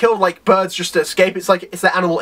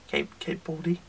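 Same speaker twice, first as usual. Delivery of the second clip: tiny, hushed reply; very quiet; 5 semitones lower, 14 dB quieter than usual. Acted. Hero giving his name when asked, very quiet. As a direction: barely let the name out, low and halting. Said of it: "He says it so quietly".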